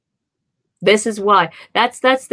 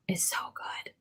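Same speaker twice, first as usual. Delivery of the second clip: breathless whispered praise; whispering; 17 dB quieter than usual. Real, not acted. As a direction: whisper it, almost reverent. Gushing about Sean Bean.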